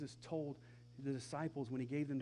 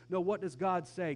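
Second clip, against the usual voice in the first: ordinary talk, nothing marked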